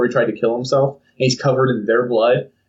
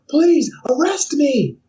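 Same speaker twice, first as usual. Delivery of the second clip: mock desperate plea; much slower than usual. Joseph begging the police to arrest him, mock desperate. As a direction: plead to be punished, hammed-up. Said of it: "He's screaming".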